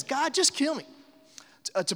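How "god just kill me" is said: high whiny plea. Retold or imitated. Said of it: "He says".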